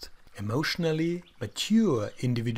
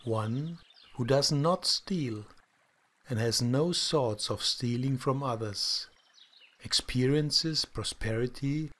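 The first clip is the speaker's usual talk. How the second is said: calm, soft guided meditation voice; soft spa whisper; 3 semitones lower, 4 dB quieter than usual. Acted. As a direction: guide them gently into stillness.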